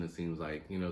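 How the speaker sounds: ordinary talk, nothing marked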